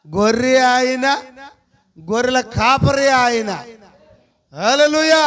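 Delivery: rising, emphatic preacher's voice, slowing on the last line; fervent preaching. Acted. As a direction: proclaim it to the church. Builds up in preaching. (ఉత్సాహంగా బోధించడం)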